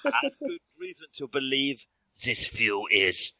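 put-on emphatic French-accented professor; hammy French accent, hitting the last word. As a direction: build to a banged-podium finish in a French accent. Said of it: "and he bangs the podium".

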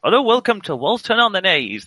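high, bright-ish country English voice; high rustic English welcome; generic rural accent. Acted. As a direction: play the rural stationmaster. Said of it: "This is my generic rural accent".

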